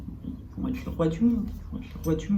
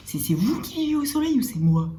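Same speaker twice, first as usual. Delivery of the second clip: pitched up high, in disbelief; incredulous; 9 semitones higher, 4 dB louder than usual. Real, not acted. Vlogger to au pair family kids, incredulous and high. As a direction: throw the question out in mock outrage.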